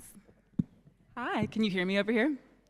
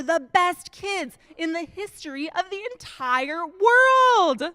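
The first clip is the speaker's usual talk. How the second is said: hammed-up praise; 7 semitones higher, 5 dB louder than usual. Exaggerated praise of kids.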